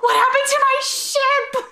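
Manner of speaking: very high, wailing imitation; mock shriek. Spike finding his ship wrecked, high mock shriek. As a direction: wail it in a comic squeal. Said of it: "You hear Spike literally shriek".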